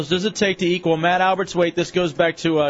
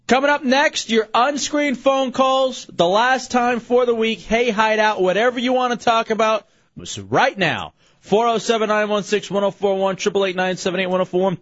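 radio promo read; 4 semitones higher than usual. Acted. Promo read for call-ins.

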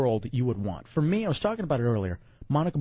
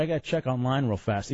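usual speaking voice

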